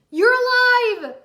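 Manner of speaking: high, loud and slow cheer; joyful exclamation. Acted. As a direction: cheer it out, overjoyed. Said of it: "Jay and Cole exclaimed".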